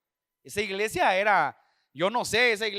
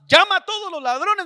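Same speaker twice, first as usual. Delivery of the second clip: louder, higher repeat of a divine order; insistent command; 9 semitones higher, 8 dB louder than usual. Retold or imitated. The Lord overrules the reluctant preacher, insistent command. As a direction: cut in high and loud, overruling the protest.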